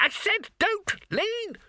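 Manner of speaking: very high, drawn-out wail; panicked cry. A narrator's reading. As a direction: wail it just before crashing. Final panicked cry before crash.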